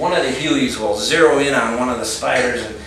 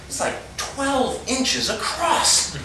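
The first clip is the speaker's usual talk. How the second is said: high, slow, strained disbelief; acted panic; 12 semitones higher, 3 dB quieter, much slower than usual. Geologist insisting on the spider's size, panicky. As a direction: stretch it out high in mock panic.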